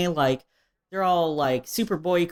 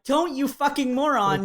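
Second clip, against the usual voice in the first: mock angry warning; 7 semitones higher than usual. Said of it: "Uranus and Neptune are like".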